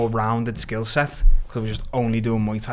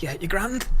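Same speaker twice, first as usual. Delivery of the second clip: higher, softer, rising mimic of the coach; coach's breezy reply; 6 semitones higher, 5 dB quieter than usual. Coach telling the fighter he's grand, imitated breezy reassurance. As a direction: imitate an easygoing coach, lilting up. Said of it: "Collie was just like".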